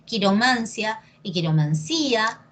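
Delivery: quiroMANcia, then quiromanCÍa; two accepted stresses. Shown as a demonstration. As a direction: Quiromancia on MAN, quiromancía on a drawn CÍ. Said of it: "depende de ti cómo lo quieras decir".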